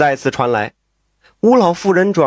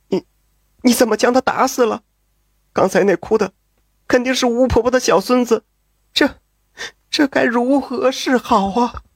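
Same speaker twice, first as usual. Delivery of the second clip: higher, stammering, panicked voice; panicked woman; 7 semitones higher than usual. A narrator's reading. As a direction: stutter in horror at the killing. Pan panics after the murder.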